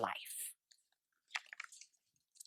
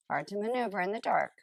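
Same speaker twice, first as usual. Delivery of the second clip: ordinary delivery, nothing marked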